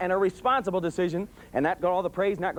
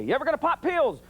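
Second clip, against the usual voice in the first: higher barked question; drill sergeant yell; 8 semitones higher than usual. Retold or imitated. Father drilling his daughters on the couch. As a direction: bark it at the line of kids. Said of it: "I'd turn and I'd say".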